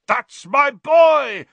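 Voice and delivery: loud, high, drawn-out triumphant yell. A narrator's reading. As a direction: yell with pride, stretching every word. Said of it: "Wood kept yelling".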